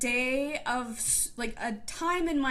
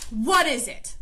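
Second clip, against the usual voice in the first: louder, clipped demand from an exasperated teacher; frustrated teacher; 7 dB louder than usual. Retold or imitated. Annoyed teacher leaning over a first grader, louder snapping demand. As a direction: retell her impatient question, pushing. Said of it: "she becomes frustrated".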